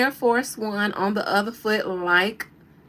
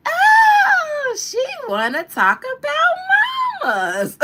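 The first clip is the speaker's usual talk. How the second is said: high, loud, laughing shout; 9 dB louder, slower than usual. Retold or imitated. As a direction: shriek it like the movie scene.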